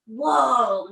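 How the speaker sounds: ordinary delivery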